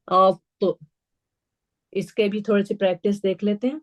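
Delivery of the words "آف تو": heavy bouncing T in افت; افت as a thick "awt-to"; heavy qalqala. Shown as a demonstration. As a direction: lift the tongue for a heavy AT and bounce it. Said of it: "تینوں sound جو ہے totally الگ".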